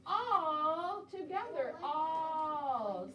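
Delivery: all together drawn out slowly; slow, stretched. Shown as a demonstration. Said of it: "Like we are".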